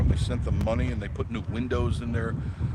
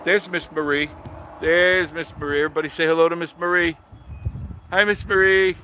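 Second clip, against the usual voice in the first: loud, higher-pitched call-out; excited greeting; 7 semitones higher, 6 dB louder than usual. Real, not acted. Viewers urged to greet Miss Marie, loud excited call. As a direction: announce her arrival brightly and loudly.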